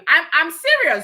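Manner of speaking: usual speaking voice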